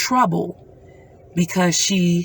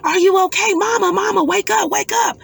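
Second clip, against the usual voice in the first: put-on much higher, urgent child's voice; frightened daughter; 12 semitones higher than usual. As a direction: wake her mother in a panic. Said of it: "she was like".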